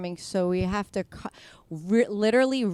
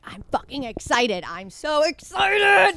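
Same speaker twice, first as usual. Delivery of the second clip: acted hyped-up excitement; 9 semitones higher, 6 dB louder than usual. Comic hyping themself before going on stage, excited shout. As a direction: pitch soaring into a drawn-out shout. Acts out pre-show self pep talk.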